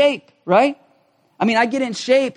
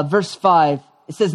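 ordinary talk, nothing marked

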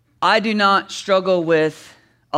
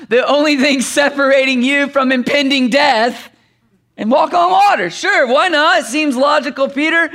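high, incredulous mock agreement; sarcastic; 6 semitones higher, 4 dB louder than usual. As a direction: play along as if it were sensible. Mocking Peter's logic in terror.

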